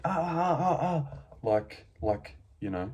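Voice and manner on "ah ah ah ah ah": imitating a fighter's high yelps of pain; high mocking cries of agony; mock pained screams. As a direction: squeal like a heel hook is cranking your knee. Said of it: "he did scream and he went".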